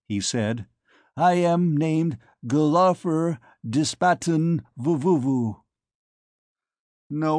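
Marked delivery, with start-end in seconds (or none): 1.1-5.6 slow, higher, alien-sounding formal voice; alien ambassador voice. Acted. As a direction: stiff alien diplomat, unhurried. Alien introduces himself.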